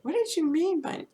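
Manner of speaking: imitating herself pressing for the catch; curious question. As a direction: ask it suddenly, up high. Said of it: "I said".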